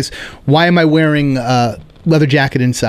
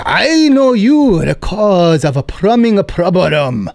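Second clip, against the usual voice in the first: put-on Korean landlord accent; much slower than usual. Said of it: "he goes".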